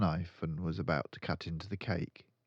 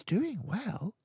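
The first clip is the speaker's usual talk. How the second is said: put-on high, slow, lightly hushed girl voice. A narrator's reading. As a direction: tell your friend, impressed. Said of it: "she whispered to Lavender".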